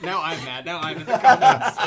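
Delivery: laughing, mock-angry rising shout; mock outrage. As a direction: play an outraged commenter, half laughing. Pretend outraged viewer.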